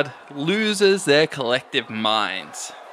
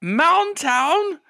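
arena announcer. Acted. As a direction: hold a high pitch and stretch the introduction. Game master voices arena announcer.

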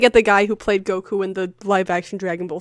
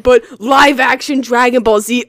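mocking imitation; 3 semitones higher, 7 dB louder than usual. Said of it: "You're like".

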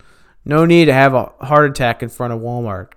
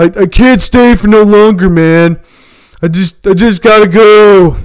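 higher and louder, a panicky jittery friend; frantic crackhead voice; 7 semitones higher, 10 dB louder than usual. Retold or imitated. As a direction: play the crackhead pleading that he has to leave, all nerves. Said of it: "He's like".

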